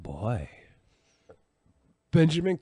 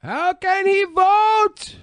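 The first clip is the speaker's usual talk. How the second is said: very high squeaky voice; 9 dB louder, much slower than usual. Pretend. Repeats even higher, far timbre.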